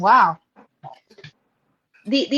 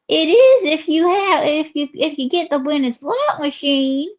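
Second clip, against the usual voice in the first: Southern lady character; 5 semitones higher than usual. Acted. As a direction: stretch the vowels like a chatty Southern belle. Host voices Ruby.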